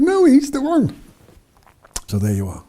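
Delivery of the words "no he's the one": very high, loud, emphatic contradiction; retold excited insistence. Girl insists to doubters it is him, retold excited insistence. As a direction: insist at the top of your range.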